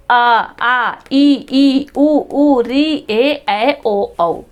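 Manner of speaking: even, connected run through the vowels; vowel recital. Shown as a demonstration. Teacher reminds pupils what vowels are, steady recital.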